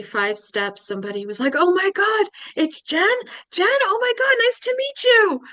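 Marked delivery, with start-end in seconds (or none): 1.6-5.4 very high, loud gushing; starstruck excitement. Retold or imitated. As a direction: squeal like a thrilled fan meeting someone. Strangers excitedly recognizing her.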